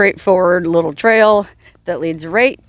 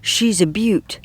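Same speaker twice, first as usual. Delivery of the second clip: hammed-up Australian accent, Crocodile-hunter style; a playful, terrible Australian accent; mock Australian nature-show voice; bad Australian accent; 4 dB louder, slower than usual. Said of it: "forgive my terrible Australian accent".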